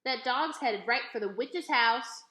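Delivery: put-on loud, slow boyish holler; hollered warning. A narrator's reading. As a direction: yell it after her, drawn out. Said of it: "Dunlap hollered".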